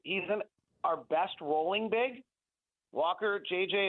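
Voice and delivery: ordinary talk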